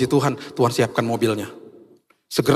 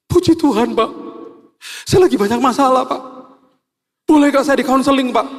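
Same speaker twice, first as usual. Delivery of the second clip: imitating a tearful driver, voice pitched much higher and louder; crying driver; 12 semitones higher, 4 dB louder than usual. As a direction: plead like a man who just broke into tears. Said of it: "Terus dia tiba-tiba nangis".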